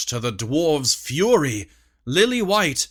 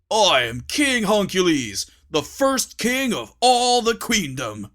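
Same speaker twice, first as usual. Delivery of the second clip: proud proclamation; 6 semitones higher than usual. Acted. Huncules proclaims himself king.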